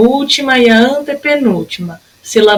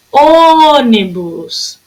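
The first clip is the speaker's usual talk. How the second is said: "Ônibus" called out, huge stretched "Ô", quick ending; longest first syllable; 2 semitones higher, 3 dB louder, much slower than usual. Shown as a demonstration. Teacher showing the longest syllable, drawn-out "Ô" in "Ônibus". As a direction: hold the "Ô" of "Ônibus" very long. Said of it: "A sílaba que durou mais tempo".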